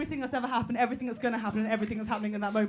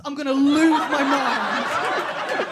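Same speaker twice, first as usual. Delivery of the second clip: slowed, louder, mock fury; slow frustrated rant; 8 dB louder, much slower than usual. Real, not acted. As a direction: drag it out in frustration. Ranting about her zone.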